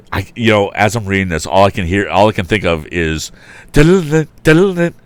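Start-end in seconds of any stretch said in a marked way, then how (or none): 3.7-4.9 high, loud sung sound effect; cartoon chase music. Shown as a demonstration. Reader imitating old cartoon chase music, high and loud. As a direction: hum the old cartoon gallop. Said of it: "music sound effects from the old cartoons".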